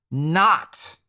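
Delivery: "not" set apart by pauses and punched; stress on NOT. Shown as a demonstration. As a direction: hit "not" hard as a word on its own. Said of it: "I'm stressing the word not".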